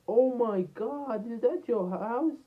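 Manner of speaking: slow, high-pitched mock astonishment; imitated amazed girl. Girl seeing his big house, high drawn-out imitated amazement. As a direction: draw it out in a high, awed girl's voice. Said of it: "It was so big that she was like".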